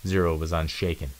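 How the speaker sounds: plain reading voice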